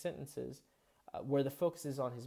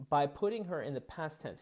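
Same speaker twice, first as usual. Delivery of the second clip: normal talking voice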